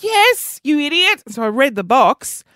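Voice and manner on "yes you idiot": high, mock-exasperated retort; retold exasperation. Friend answers whether she's serious, exasperated.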